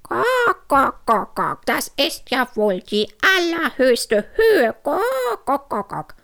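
high squawking with drawn-out clucks; furious hen. A narrator's reading. Hen loses her temper at Hugo, outraged cackling. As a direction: blow your top like a clucking hen. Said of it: "Da platzte Henne Hanni der Hühnerkragen".

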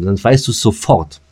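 ordinary talk

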